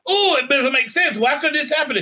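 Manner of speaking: high, hammed-up baffled heroine; mock bewilderment. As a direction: wail the puzzlement as parody. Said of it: "It's not like she's asking a question to herself".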